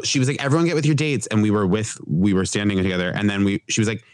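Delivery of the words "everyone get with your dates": bossy mom voice. Retold or imitated. Prom mom giving orders.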